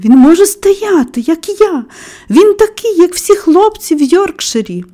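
high, elated proclamation; excited cry. A narrator's reading. Friend proclaiming the boy is like others, excited cry. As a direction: exclaim it with pitch held high. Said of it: "скрикнув Дікін".

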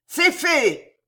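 very high, loud triumphant shout; shouted announcement; yelled. A narrator's reading. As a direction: yell it down to those below. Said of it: "hurla Bem en se penchant".

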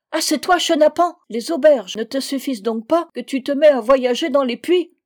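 high, loud scolding; furious. A narrator's reading. Fanta scolds the drunkard in the well, furious. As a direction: tear into him indignantly. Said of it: "Elle était furieuse".

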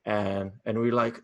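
usual speaking voice

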